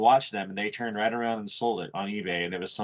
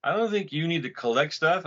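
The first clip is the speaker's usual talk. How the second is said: higher, clearer repeat; repeated higher for clarity; 6 semitones higher than usual. Real, not acted. Repeating it after his friend asks what's that, higher. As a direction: say it again, pitched up so he catches it. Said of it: "what's that?".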